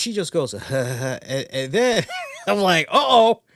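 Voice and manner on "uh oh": high, loud uh-oh; sudden realization of trouble; loud comic alarm. Retold or imitated. Realizes it's the bad Pizza Hut.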